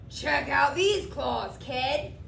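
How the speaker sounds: rasping monster. A narrator's reading. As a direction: rasp it like a tough monster. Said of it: "a third voice from under the bed rasped".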